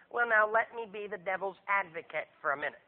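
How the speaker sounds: a hint of a smug church member; mock smug objector. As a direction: mimic a smug devil's advocate.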